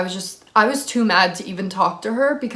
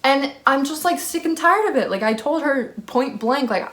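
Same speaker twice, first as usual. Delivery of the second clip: normal talking voice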